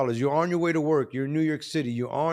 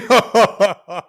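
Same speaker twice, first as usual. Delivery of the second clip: a sudden loud burst of laughter; loud real laughter; 5 semitones higher, 8 dB louder than usual. Real, not acted. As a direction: let out a big laugh at the clip. Reacting to robbery footage.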